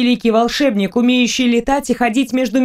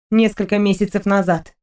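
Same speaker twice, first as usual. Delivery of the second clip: plain reading voice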